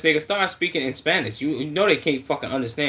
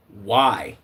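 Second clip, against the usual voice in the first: ordinary delivery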